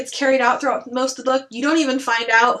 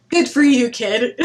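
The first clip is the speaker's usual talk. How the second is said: louder laughing dad line; laughing quote; 5 dB louder than usual. Retold or imitated. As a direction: laugh through it. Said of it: "Charlie goes".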